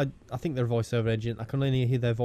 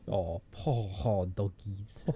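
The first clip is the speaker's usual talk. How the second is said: slow, lower mock South London French accent; 5 semitones lower, 5 dB quieter, much slower than usual. Playing along with friend's mishearing, South London French accent. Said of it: "a sort of South London Frenchie".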